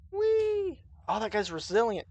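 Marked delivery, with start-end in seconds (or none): 0.1-0.8 high gleeful squeal; gleeful whee. Real, not acted. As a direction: squeal with glee. Gleeful cry at enemies.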